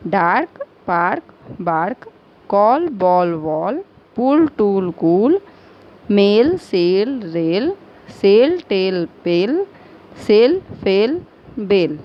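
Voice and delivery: rhyming words read slowly in sets of three, last word of each set falling away; teacher's measured word-list drill, each word clearly separated; pronunciation drill. Shown as a demonstration. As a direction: model each rhyming word for learners to copy. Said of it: "एक बार फिर से हम बता दे रहे हैं".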